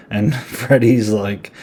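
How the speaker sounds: ordinary talk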